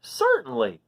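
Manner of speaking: a hint of a cheerful mouse agreeing; bright little mouse yes; lightly voiced mouse. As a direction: say it gladly as the mouse. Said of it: "said the mouse".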